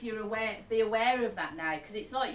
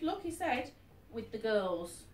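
usual speaking voice

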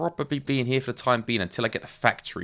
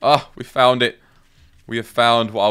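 much louder, thrilled announcement; triumphant; 11 dB louder than usual. Real, not acted. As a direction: cheer it like a discovery. Triumph at finding the colour.

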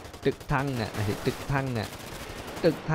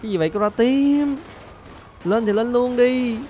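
exasperated; 9 semitones higher than usual. Real, not acted. Streamer scolding teammate who keeps jumping down.

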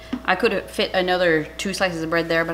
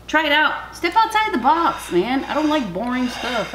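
excited pep talk; 7 semitones higher, 5 dB louder than usual. Real, not acted. Hyping up her viewers.

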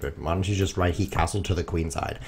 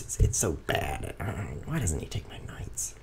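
whispered disbelief; 3 semitones lower, 5 dB quieter than usual. Real, not acted. Muttering in disbelief.

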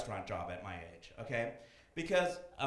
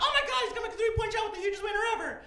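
very high, fast excited call; hammed-up sports hype; mock hype call; 5 dB louder, faster than usual. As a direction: shout it like a sports announcer. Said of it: "and you're like".

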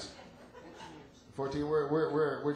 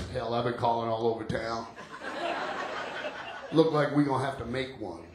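drawling mechanic; 7 semitones lower than usual. Retold or imitated. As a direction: play the clueless mechanic, lower. Mechanic voiced in story.

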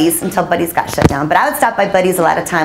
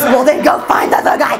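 mock editor order; 5 semitones higher than usual.

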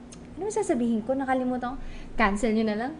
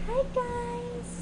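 high, sing-song cartoon greeting; exaggerated cartoon intonation; 12 semitones higher, 4 dB quieter than usual. Real, not acted. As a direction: squeal the hello up high. Streamer greets live audience.

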